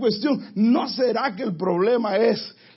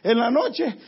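ordinary delivery

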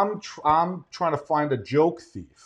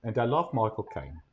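lower, much softer British accent impression; Michael Caine; 3 semitones lower, 7 dB quieter than usual. Comic praising a favorite Alfred actor, in his British accent. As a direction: quietly slip into a British film-butler accent. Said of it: "That's a great impression".

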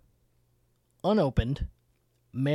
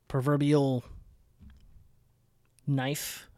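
normal talking voice

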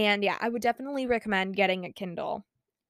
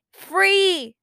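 excited cry; 9 semitones higher, 8 dB louder than usual. Real, not acted. Gloating over free books.

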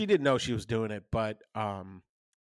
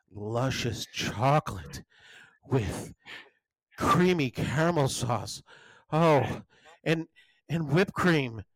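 slow, drawn-out menu seduction; mock sexy voice; 3 semitones higher, slower than usual. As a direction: linger on each dessert as if seduced by it. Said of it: "in a sexy voice".

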